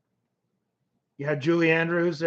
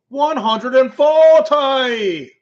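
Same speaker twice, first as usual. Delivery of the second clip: darts announcer; 8 semitones higher, 10 dB louder, much slower than usual. Retold or imitated. Imitating English darts TV caller.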